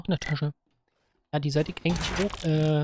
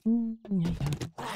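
normal talking voice

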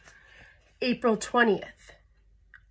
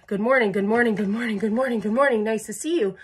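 repeated greetings; faster than usual. Acted. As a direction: run of rattled-off, singsong hellos. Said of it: "six hundred more of them in line".

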